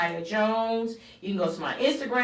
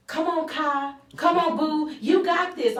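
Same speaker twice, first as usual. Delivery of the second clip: high, loud pep-talk voice; Jocelyn; 9 semitones higher, 5 dB louder than usual. Retold or imitated. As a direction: imitate a friend urging her on. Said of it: "I call Jocelyn".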